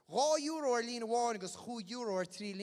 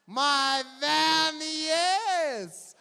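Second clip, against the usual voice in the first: high, loud, stretched cheer; excited cheer; 8 semitones higher, 13 dB louder, much slower than usual. Real, not acted. MC cheering as a raffle ticket wins, excited shout. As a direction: celebrate the win out loud. MC celebrating a winner.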